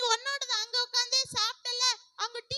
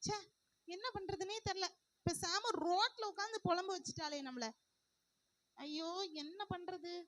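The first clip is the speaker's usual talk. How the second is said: softer, lower sulking to herself; sulky lament; 4 semitones lower, 4 dB quieter than usual. Acted. Sulking alone after the fight with her sister. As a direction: mope about the fight.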